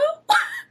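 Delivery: a very high squeak of a laugh; high disbelieving laugh. Real, not acted. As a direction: let a squeaky laugh burst out at the word hero. Laughs at calling him hero.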